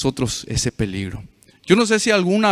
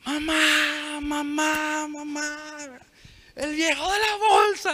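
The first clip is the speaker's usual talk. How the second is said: much higher, terrified call for mum; retold child's scream; 12 semitones higher, 7 dB quieter, slower than usual. Scared boy calling his mother as the bag man approaches, high-pitched retold scream. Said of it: "comencé a dar gritos".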